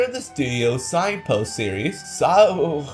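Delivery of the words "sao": ordinary delivery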